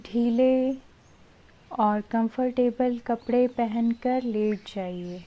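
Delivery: slow yoga nidra guidance, long pauses; guided relaxation. Real, not acted. (धीमी, शांत योग निद्रा निर्देश की आवाज़, ठहर-ठहर कर)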